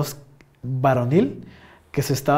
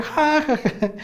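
spelled-out ha ha ha, higher, falling away; mock cackle of glee; ja ja ja, acted out; imitated laugh; 10 semitones higher, much slower than usual. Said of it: "me empecé a reír".

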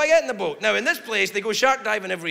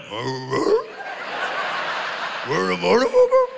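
lightly voiced dogs looking around; curious dogs. Bahamas dogs reacting to the yell, a light doggy voice. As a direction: perk up like a dog hearing its name. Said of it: "dogs on the Bahamas were like".

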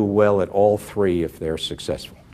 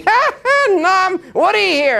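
much higher, laughing through the words; laughing hysterically; 3 dB louder than usual. Retold or imitated. Woody phoning Norman with big news, laughing hard. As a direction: burst in cackling with joy. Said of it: "laughing hysterically".

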